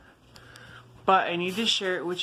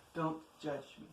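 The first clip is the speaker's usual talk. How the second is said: softer, a near-whispered repeat; quiet; 2 semitones lower, 14 dB quieter than usual. Real, not acted. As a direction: murmur it under your breath.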